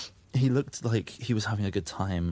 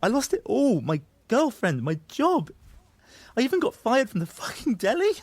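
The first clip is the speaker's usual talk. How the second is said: distraught outburst; 12 semitones higher than usual. Acted. Confessing lost love and job to Annie, distraught. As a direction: voice leaping high with exasperation.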